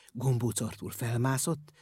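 ordinary delivery, nothing marked

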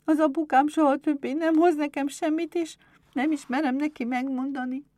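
put-on high child voice, close to tears; near-tearful little girl. A narrator's reading. Berbel fears telling her father, high tearful child voice. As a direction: play a child about to cry. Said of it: "Berbel majdnem elpityeredett".

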